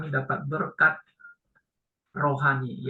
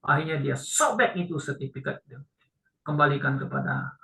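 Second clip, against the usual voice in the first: ordinary talk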